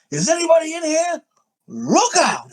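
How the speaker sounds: high-pitched flamboyant movie quote; over-the-top Tim Curry. Retold or imitated. Friend quoting a line from a dark-room movie scene, flamboyant. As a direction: throw it high and theatrical, utterly hammed-up. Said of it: "it's so over the top, so flamboyant".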